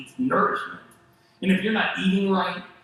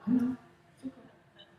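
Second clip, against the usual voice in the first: soothing whisper; 8 semitones higher, 3 dB quieter than usual. Retold or imitated. Husband calming wife.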